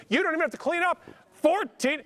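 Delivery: excited call-out. Real, not acted. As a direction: jump up in pitch and pounce on the number. Auctioneer calls out new bid.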